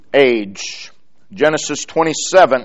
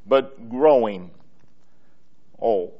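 growing lower, then a long pause; stress on growing; 5 semitones lower, 9 dB quieter than usual. Real, not acted. Preacher contrasting it with becoming, stress on growing. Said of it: "I emphasize the word growing".

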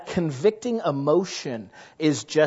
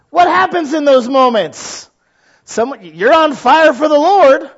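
louder, higher, on fire; fiery preaching; 9 semitones higher, 7 dB louder than usual. Acted. Preacher drives home the point, much louder and higher. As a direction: raise the roof on it.

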